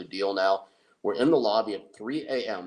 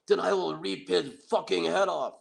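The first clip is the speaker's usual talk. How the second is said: imitated yell; 9 semitones higher than usual. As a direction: ham up a fighter's yell. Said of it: "glares right in the camera and yells".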